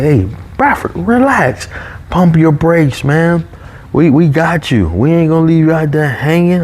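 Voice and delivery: high-pitched imitation of a boss calming someone; imitating a "relax, we got you" boss; retold boss reassurance. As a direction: talk him down, pitched well up. Retold boss telling him to relax.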